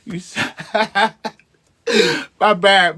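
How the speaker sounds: real laughter cutting off the sentence; can't stop laughing, apologising. Real, not acted. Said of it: "Listen, I'm laughing".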